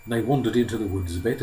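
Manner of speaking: ordinary delivery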